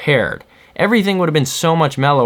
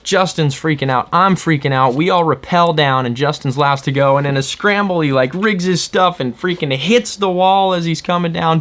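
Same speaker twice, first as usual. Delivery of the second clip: ordinary talk, nothing marked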